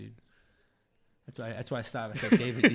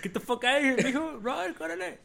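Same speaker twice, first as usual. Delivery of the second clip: mock shout; 13 semitones higher, 4 dB louder than usual. Imagined yelling at his kid.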